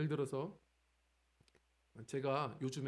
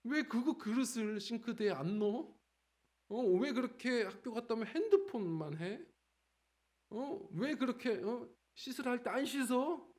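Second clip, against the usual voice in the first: higher, exasperated nagging; imitating nagging dad; 6 semitones higher than usual. Father mimics himself nagging his kids, higher and exasperated. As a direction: replay your own nagging in a higher voice. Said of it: "제가 정신 차려보면 잔소리하고 있더라고요".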